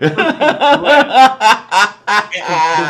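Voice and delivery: high, loud laugh through the word; can't stop laughing. Real, not acted. Still laughing with a friend about German, laughing.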